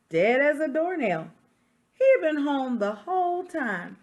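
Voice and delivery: story narration. Acted. Reading a picture book to children, storyteller voice. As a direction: tell it like the wolf defending himself.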